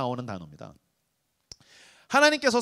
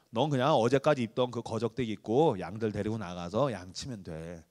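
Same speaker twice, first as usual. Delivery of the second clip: low, soft dismissive order; lightly voiced father; 9 semitones lower, 5 dB quieter than usual. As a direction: send the boy off offhandedly. Father sending David to sheep. (막내에게 양 치라는 아버지, 낮고 작게)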